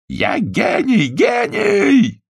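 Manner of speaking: slow then shrieking high cry; frenzied yell. A narrator's reading. Mad painter yells through the wall, frenzied. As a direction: bellow your genius through the wall. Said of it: "истошный крик".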